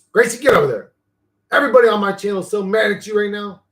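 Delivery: calling Gracie across the room; calling the dog. Real, not acted. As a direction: shout her over, half-teasing.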